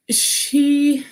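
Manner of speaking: usual speaking voice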